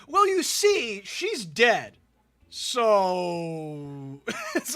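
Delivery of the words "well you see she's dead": high, loud mock excuse. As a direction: squeak it out as a lame excuse. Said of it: "Cronington's excuse was".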